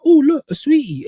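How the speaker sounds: high, loud cartoon quote; cartoon character impression. Fan recalling a Family Guy scene, high cartoon voice. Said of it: "Family Guy episode where the guy's like".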